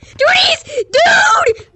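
high, loud, crying out in terror; terrified scream. Real, not acted. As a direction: shriek in real panic. Touched the ghost, screams.

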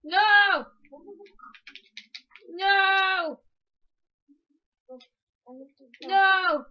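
loud, high cries of no; distressed shouting. Real, not acted. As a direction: yell in real dismay. Lost her horse in game.

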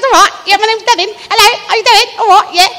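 put-on little British boy, high and chatty; cheeky British lad greeting people; high-pitched British kid voice; Devin. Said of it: "there's a little boy".

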